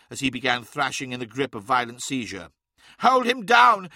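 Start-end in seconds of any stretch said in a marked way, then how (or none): 3.0-3.9 loud high urgent shout. Acted. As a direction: yell the order across the fight. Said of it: "shouted Araman".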